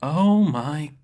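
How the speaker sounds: sharp-tongued woman losing patience; exasperated cut-in. Acted. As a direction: roll your eyes out loud. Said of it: "Karana cut in".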